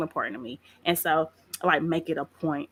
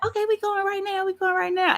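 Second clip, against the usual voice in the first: mock hurried apology; 13 semitones higher than usual. Hurrying apologetic reply.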